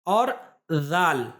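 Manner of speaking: जाल drawn out slowly, tongue tip past the teeth; letter demo. Shown as a demonstration. Teacher shows students the tongue position, slow letter demo. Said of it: "दांतों से बाहर निकाल कर".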